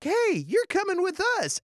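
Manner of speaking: lightly voiced excited kids; eager child voice. Children invite the girl to play.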